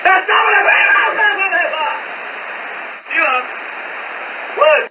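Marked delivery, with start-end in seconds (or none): none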